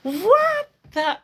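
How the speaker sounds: high, loud exclamation of disbelief; outraged disbelief. Retold or imitated. Woman retelling her rejection over French, outraged disbelief. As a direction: cry out, drawing it high on WHAT. Retold outrage at being told.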